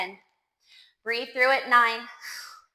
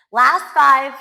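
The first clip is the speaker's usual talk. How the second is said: shouted countdown cue; loud coaching call; 12 dB louder than usual. Real, not acted. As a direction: push them hard on the last reps. Coach pushes the final reps.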